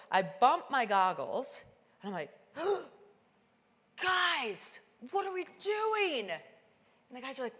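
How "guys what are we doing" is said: alarmed, much higher and louder; jolted out of a daydream; shocked. Retold or imitated. Climber suddenly seeing the storm, crying out in alarm. Sudden whiteout after bumping goggles.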